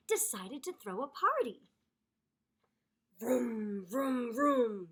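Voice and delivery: ordinary delivery, nothing marked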